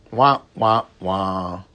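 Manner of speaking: low, falling "wah, wah, wah"; comic wah-wah sound, pitched low; mock wah-wah noise. Spelt mock wah-wah noise mid-sermon.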